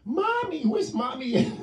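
put-on high, whiny child calling for mommy; a child's high wail, lightly mocked; scared kid voice. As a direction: play a frightened little kid. Kids voiced waking from nightmares.

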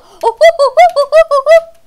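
high, loud, rapid string of ohs; excited hooting. Real, not acted. Player in a tricky bit of the game, excited hooting. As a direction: hoot each one in quick bursts. Startled run of cries in game.

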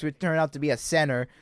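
ordinary talk, nothing marked

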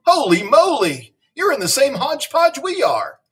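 high-pitched storybook amazement; astonished exclamation. A narrator's reading. Principal hearing the nurse talk nonsense too, high amazed exclamation. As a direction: exclaim it high, playfully aghast.

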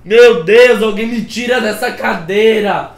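loud, pained inner cry of agony; a hammed-up yell of pain at the tattoo. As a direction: shout it like the needle hurts. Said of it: "por dentro eu tava".

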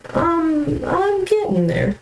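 high squeaky voice. Pretend. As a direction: squeak "I'm getting there" way up high. Repeats line, first take squeaky.

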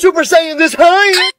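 very high, outraged yell; angry dad threat. Acted. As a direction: threaten a smack, voice shooting up.

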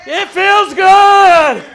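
high, loud shouts of praise; exultant preaching. Real, not acted. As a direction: proclaim it joyfully to the congregation. Preaching at full force.

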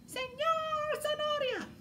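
very high, strange puppet voice; carrot puppet voice. Acted. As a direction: announce yourself as the carrot puppet. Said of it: "Y con". (voz aguda de títere zanahoria)

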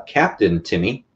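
ordinary delivery

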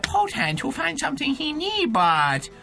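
hammed-up Chinese-accented sage; put-on mock-Chinese trainer voice, swelling at the end. Dog trainer instructs the player, hammed-up mock Chinese accent.

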